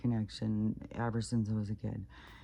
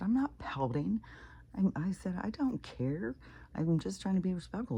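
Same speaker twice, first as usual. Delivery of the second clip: imitating a defensive comeback, higher pitched; retold protest; 8 semitones higher than usual. Daughter retelling her answer to being accused of pouting, defensive protest.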